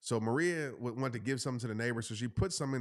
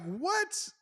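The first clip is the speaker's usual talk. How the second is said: stunned what; 14 semitones higher than usual. Retold or imitated. Brother admits taking the grill.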